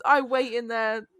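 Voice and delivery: ordinary delivery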